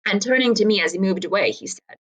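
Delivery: reading a tall tale aloud to the class; story narration. A narrator's reading. Teacher reading Twain to students, story narration. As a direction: tell the old story with a folksy lilt.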